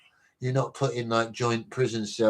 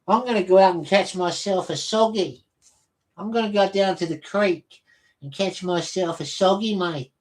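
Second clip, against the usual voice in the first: lightly put-on Australian accent, pitched up; a hint of a jokey Aussie bloke going fishing; 5 semitones higher than usual. Streamer jokingly voicing an Aussie angler to chat, Australian accent. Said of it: "it sounds Australian, doesn't it".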